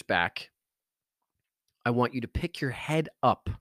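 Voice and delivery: calm them down and walk them through each breath; guided meditation. Acted. Guided breathing and stillness exercise.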